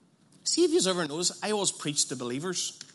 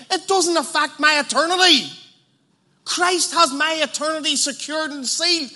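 loud, high, fervent preaching; rising revival preacher voice; preaching at full force; 9 semitones higher, 7 dB louder than usual. Acted. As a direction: preach it out to the whole hall. Preaching on Christ in control.

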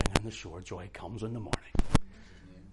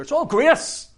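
high, loud burst of mock defiance; mock shouted excuse; 14 dB louder, slower than usual. Preacher mimicking churchgoers excusing their sin, high mocking yell. Mocking lax believers, acted.

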